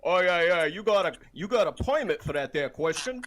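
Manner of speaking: high, loud mock British accent. Mocking a baffled listener's question, exaggerated British accent. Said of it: "you don't like my British accent".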